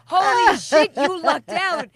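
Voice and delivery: loud, high mock amazement; amazed exclamation. Friends telling her how lucky she is, loudly amazed. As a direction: blurt it out, hugely impressed. Others marvel at her luck.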